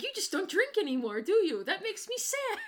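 high-pitched playful complaint; jokey, increasingly high whine; mock lament. Mock lament to screen character.

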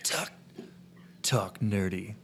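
slow whispered pretend seduction; hushed, jokey sexy voice; mock sensual. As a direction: whisper it with hammed-up sultriness. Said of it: "making sexy sensual voices".

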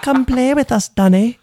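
put-on high, eerie British child voice; high sing-song ghostly British invitation; creepy British twins. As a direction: chant it like a creepy English twin. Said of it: "the accent is creepier".

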